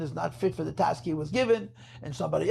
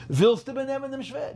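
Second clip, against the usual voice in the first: quoting the Rebbe; 7 semitones higher than usual. Retold or imitated. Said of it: "you hear the Rebbe say".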